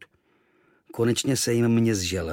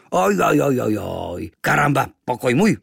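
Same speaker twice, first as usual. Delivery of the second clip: protesting native; 2 semitones higher, 5 dB louder than usual. Acted. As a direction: claim the room back.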